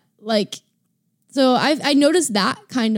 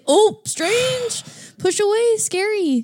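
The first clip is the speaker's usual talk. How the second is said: much higher recoiling voice; mock startled recoil; 10 semitones higher, much slower than usual. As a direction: recoil at the stranger. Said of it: "our natural psychological reaction is to say".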